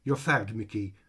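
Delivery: a hammed-up French ringmaster firing someone; overdramatic French accent. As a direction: announce it grandly, in a bad French accent. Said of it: "overdramatically announced".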